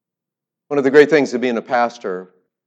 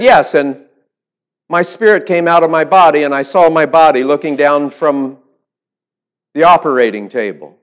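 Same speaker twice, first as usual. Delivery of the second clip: much higher and louder, full of wonder; excited old woman; 6 semitones higher, 10 dB louder than usual. Retold or imitated. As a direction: tell it like an amazed old lady, high and loud. Old woman retells her death.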